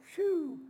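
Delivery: high-pitched mock sigh of relief; exaggerated relieved phew. Preacher behind the man's back plays to the crowd, high phew. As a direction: let out a big comic phew.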